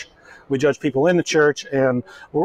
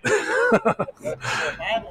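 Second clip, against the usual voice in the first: laugh; 7 dB quieter than usual. Real, not acted. Laughing at own joke.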